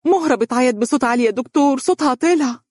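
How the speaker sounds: high, urgent, excited report to the doctor; eager. Acted. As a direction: blurt it out breathless with eagerness. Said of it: "وهي تقول للطبيب بلهفة".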